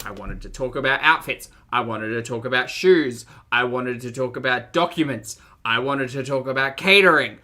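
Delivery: from firm to shouting; rising frustrated tirade; building rant. Real, not acted. Ranting about skipped wedding planning.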